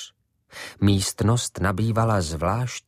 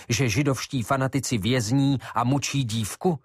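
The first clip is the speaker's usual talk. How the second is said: ordinary delivery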